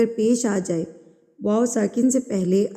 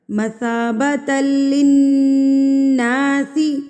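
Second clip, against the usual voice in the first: very slow, drawn-out tajweed chant; Quran recitation; 4 semitones higher, 5 dB louder, much slower than usual. Shown as a demonstration. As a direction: stretch the verse melodically. Recites the verse. (ٹھہر ٹھہر کر تلاوت)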